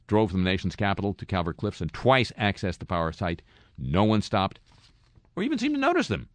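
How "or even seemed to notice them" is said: high, sing-song falsetto; mock falsetto. A host marveling at lax security in a high falsetto. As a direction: squeal it out in high disbelief.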